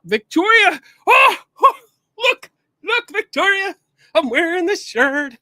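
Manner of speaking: high, loud burst of excitement; excited calling out. Real, not acted. Host showing viewer Victoria a shirt, excited calling out. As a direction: grab a friend's attention, loud and high.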